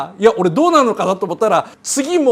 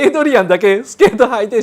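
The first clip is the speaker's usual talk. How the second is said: ordinary delivery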